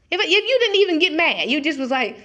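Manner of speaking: normal talking voice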